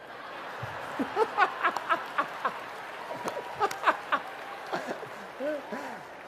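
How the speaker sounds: long airy chuckle; breathy laugh. Real, not acted. Laughs at his own story.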